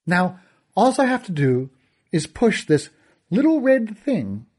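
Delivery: gloating villain. Acted. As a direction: toy with the boy, mock him.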